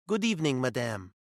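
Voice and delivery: a waiter's hammed-up French accent; lightly put-on French accent, polite. Waiter greeting his ex in a French accent. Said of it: "putting on my best French accent".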